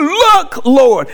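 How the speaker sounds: exclaiming. Retold or imitated. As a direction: call it out with an exclamation point. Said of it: "He didn't say, "Oh".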